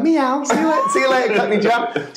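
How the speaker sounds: high sing-out taunt starting with a meow; high-pitched mocking farewell; teasing send-off. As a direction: gleefully needle your mate as he leaves. Taunting John with cat noise and goodbye.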